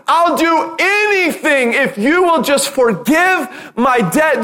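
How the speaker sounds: desperate pleading, high and drawn out; high, slow, imploring cry; crying out for mercy. Retold or imitated. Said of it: "He's crying out saying".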